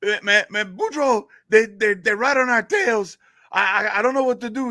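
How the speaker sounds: high, loud, panicked Cajun sidekick; hammed-up Cajun panic; Cajun joke character. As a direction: stammer the warning in mock panic. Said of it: "get into this character here".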